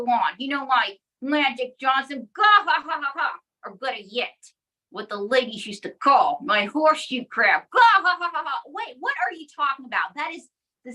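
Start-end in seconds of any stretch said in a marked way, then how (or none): 2.3-3.3 hammy puppet laugh. Acted. Puppet laughs at own joke. 7.7-8.6 loud cartoon cackle, fading out; hammy puppet laugh. Puppet laughs at own joke.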